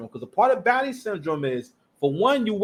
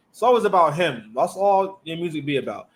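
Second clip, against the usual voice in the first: ordinary delivery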